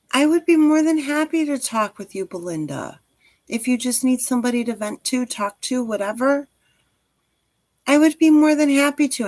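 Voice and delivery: reach out gently like a friend; tender offer to listen. Real, not acted. To viewer Belinda offering to listen, tender and caring.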